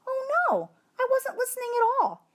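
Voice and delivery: a jolt of panic at missing the lesson; sudden alarm. Acted. Hamster realizes he drifted off.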